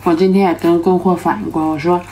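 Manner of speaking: usual speaking voice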